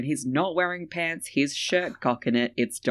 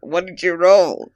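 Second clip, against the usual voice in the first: loud demand; 5 semitones higher, 10 dB louder than usual. Acted. Louder repeat of question.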